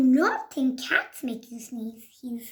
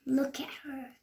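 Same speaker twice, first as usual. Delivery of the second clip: soft unvoiced aside; whisper; 9 dB quieter than usual. Real, not acted. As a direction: whisper it to the listener.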